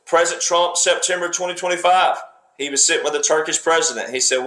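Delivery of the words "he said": ordinary talk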